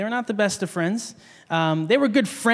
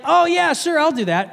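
mildly mock eager, pitched up at first; eager agreement; 7 semitones higher, 3 dB louder than usual. Boy agreeing to his friends' five-dollar dare, eager. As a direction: lightly imitate a kid saying yes readily.

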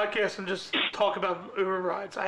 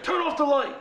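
raised, higher-pitched command; urgent order; 8 semitones higher, 6 dB louder than usual. Retold or imitated. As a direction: order the driver loudly and high. Rider urgently orders the driver.

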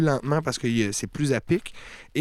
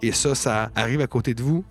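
ordinary talk, nothing marked

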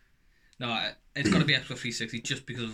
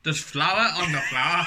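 slow, loud Northern Irish attempt; Northern Irish accent; 4 semitones higher, 7 dB louder, slower than usual. Shown as a demonstration. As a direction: have a laughing go at Northern Irish. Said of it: "You try a Northern Irish accent".

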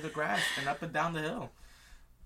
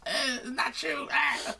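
high, squealed protest; hammed-up hyperventilating denial; mock conniption; 12 semitones higher, slower than usual. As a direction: squeak it out between comic gasps. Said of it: "she had a conniption".

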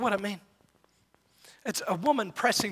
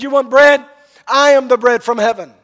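bold proclamation; 4 semitones higher, 12 dB louder than usual. Acted. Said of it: "He said".

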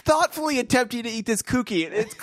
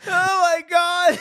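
high, loud and drawn-out disbelief; amazed; 10 semitones higher, 5 dB louder, slower than usual. Real, not acted. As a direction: stretch it out, can't believe it. Reacts to boy missing cookie.

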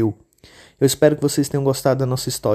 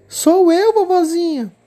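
put-on much higher, louder little-girl voice; the girl calling out to grandma; 6 dB louder, slower than usual. Menina responde. (menininha, voz aguda)

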